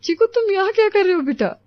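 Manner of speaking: finding him hiding, caught off guard; high surprised question to her son; surprised mother. Acted. हे भगवान.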